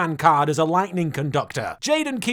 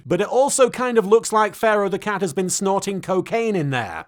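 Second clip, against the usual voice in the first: ordinary talk, nothing marked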